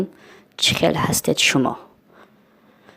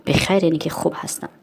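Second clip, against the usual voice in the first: ordinary talk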